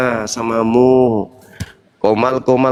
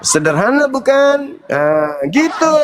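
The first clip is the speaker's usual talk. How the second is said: high, amused rhetorical question; laughing taunt; 8 semitones higher than usual. Real, not acted. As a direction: sneer through a laugh.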